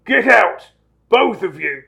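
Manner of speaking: croaked order. Acted. Uncle throws both boys out, loud croaked order. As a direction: order them out forcefully. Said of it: "croaked Uncle Vernon".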